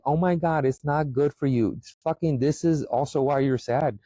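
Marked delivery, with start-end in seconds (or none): 0.0-1.7 high, whiny mock vegan voice. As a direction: mimic a fussy vegan turning down honey. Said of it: "and you're gonna sit there and be like".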